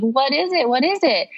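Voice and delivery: high-pitched, insistent excited questions; eager asking. Retold or imitated. New mother to the officers about the baby, eagerly asking. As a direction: imitate impatient excitement, pitch lifting up.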